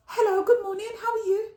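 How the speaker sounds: cheery greeting. Retold or imitated. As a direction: greet him all sunny and bright. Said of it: "I go".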